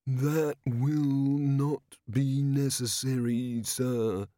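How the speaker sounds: slow, monotone, drawn-out delivery; ponderous, level servant's reply; Dorfl's character voice. A narrator's reading. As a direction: speak slowly and evenly, every word stretched. Dorfl answers Vimes in character.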